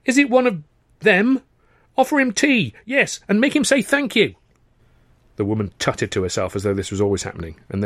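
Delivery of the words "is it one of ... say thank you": put-on high, anxious old man calling from inside; fretful, worried call from another room. A narrator's reading. Old husband calling anxiously from inside the house. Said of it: "said a man's anxious voice".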